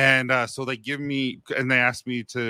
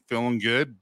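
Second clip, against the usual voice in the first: usual speaking voice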